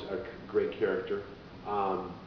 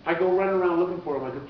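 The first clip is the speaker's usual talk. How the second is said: ordinary delivery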